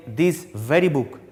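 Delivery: slow, emphatic push on "very"; "very" stressed and set apart from the words around it. Shown as a demonstration. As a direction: give "very" extra weight, slowly. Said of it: "that means I am giving more stress".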